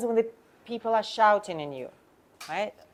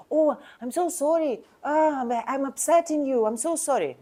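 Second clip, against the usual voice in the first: higher, gushing apology; overdone apology; 6 semitones higher than usual. Shown as a demonstration. Agent showing the wrong, fawning tone of apology. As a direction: lay the sorry on too thick. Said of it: "My tone is like".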